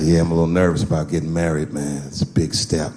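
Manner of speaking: low-pitched, confiding friend; imitated buddy, pitched well down; friend's voice. Voices friend confiding wedding nerves.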